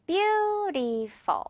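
BEAUTIFUL stretched very slowly into three beats; three drawn-out syllables. Shown as a demonstration. Teacher showing learners the three syllables, BEAUTIFUL slowly drawn out. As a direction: draw out each syllable of "beautiful", high first. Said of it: "이렇게 세 번 발음이 나지만".

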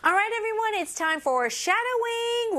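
high-pitched, bright call to the class; rally everyone for practice; peppy announcement. Real, not acted. Announcing the shadowing segment.

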